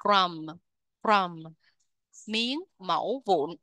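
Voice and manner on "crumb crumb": "Crumb" closed on a humming M; final M sound. Shown as a demonstration. Teacher explaining the word's last sound, final M in "Crumb". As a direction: end "Crumb" on M, dropping the B. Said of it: "cái âm cuối của nó là âm M".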